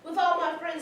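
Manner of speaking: ordinary talk, nothing marked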